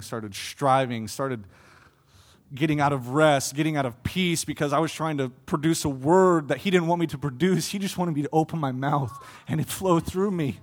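usual speaking voice

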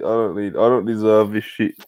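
ordinary talk, nothing marked

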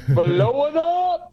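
loud, slow, drawn-out cheer; excited shout. Real, not acted. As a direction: belt it out with glee. Excited cheer after laugh.

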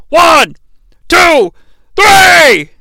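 rising, loud three-count; excited counting. Acted. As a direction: count the pin with building hype. Counting the pinfall.

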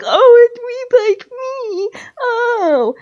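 mimicking his fake humility in a high crying whine; mock whine. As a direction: drag it out like a fake sob. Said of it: "And you're like".

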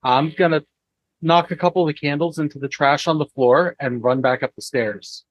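ordinary talk, nothing marked

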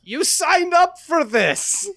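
slow, high, loud veteran yell; mock shout. Veteran berating a reluctant recruit, mock shout. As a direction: bellow each word in hammed-up outrage.

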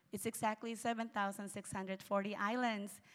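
ordinary delivery

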